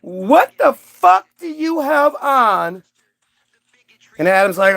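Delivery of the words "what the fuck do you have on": high, drawn-out horrified outburst; appalled shock. Acted. God seeing Adam in shorts, high hammed-up horror. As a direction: gasp it out slow and scandalised.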